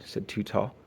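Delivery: ordinary delivery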